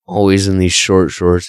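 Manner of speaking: normal talking voice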